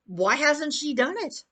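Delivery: ordinary talk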